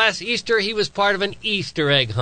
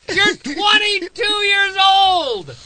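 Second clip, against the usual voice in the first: very high, building to a long shout; exasperated yelling; 12 semitones higher, 3 dB louder than usual. Real, not acted. Father complaining his grown son couldn't find eggs, exasperated yelling. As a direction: rant upward into a drawn-out holler. Rant peaks about son's egg hunt.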